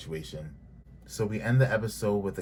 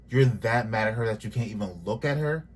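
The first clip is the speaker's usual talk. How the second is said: ordinary talk, nothing marked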